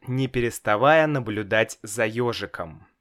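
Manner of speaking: ordinary delivery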